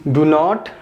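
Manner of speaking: usual speaking voice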